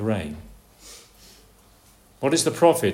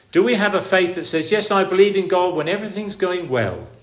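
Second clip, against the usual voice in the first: rising, louder preaching build; fervent preaching; 5 semitones higher, 5 dB louder than usual. Acted. Preacher challenging a fair weather faith, building loud. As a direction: press the question harder each time.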